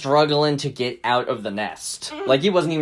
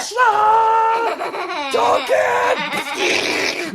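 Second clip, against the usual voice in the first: high, hammed-up fighting scream; mock battle yell; much slower than usual. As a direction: yell it like a kung fu attack. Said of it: "He was like".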